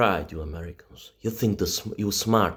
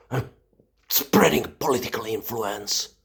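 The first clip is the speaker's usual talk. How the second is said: normal talking voice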